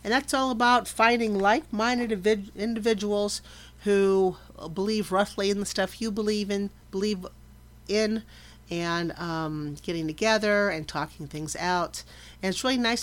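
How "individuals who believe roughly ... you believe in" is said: ordinary talk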